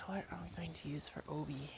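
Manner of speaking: whispered to herself under the breath; whispering. Real, not acted. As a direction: whisper it like thinking aloud. Muttering to herself while sewing.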